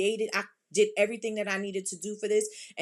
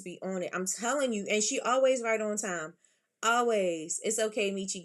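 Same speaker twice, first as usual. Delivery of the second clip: ordinary talk, nothing marked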